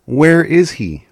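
urgent asking tone on "Where is he?"; questioning emphasis. Shown as a demonstration. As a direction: lift and lean into "Where is he?" like a real question. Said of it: "and you're saying".

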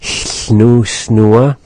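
Snoo, snua with hissed side air; lateral fricative. Shown as a demonstration. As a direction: start Snoo, snua with a voiceless lateral hiss. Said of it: "this is going directly into that word snooze".

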